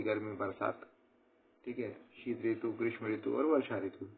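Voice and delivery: usual speaking voice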